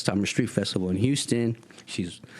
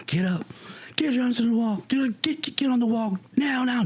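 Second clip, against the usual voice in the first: high-pitched mock police order; barking cop. Cop to kids being thrown on the wall, high-pitched barked orders. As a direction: order them up against the wall in a raised, urgent pitch.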